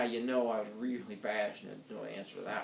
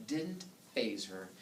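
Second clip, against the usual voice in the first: ordinary delivery, nothing marked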